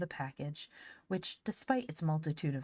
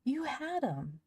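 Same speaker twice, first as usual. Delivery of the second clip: excited scream; 10 semitones higher, slower than usual. Acted. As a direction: burst in shouting the good news. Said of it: "he screamed, all out of breath".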